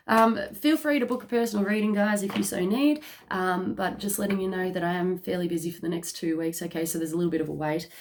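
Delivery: ordinary talk, nothing marked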